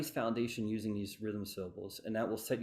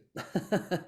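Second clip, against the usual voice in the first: short chuckle, pitched up; laugh; 6 semitones higher than usual. Real, not acted. Teacher after apologising for the singing, short laugh. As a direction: laugh it off briefly.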